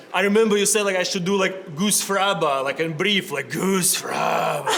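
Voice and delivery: put-on Russian accent. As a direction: drag out Goose Fraba like a patient. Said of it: "my crazy, like, Russian accent".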